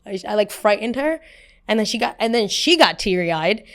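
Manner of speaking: normal talking voice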